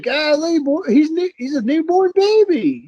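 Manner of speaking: high, mock-scolding voice; squeaky scolding of a baby. Telling the boy off, high mock scold. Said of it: "I was like".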